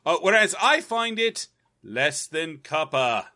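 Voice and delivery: slow mock British musing. Co-host disagrees in the joke show with a slow mock British accent. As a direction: play the stuffy British pundit. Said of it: "British version".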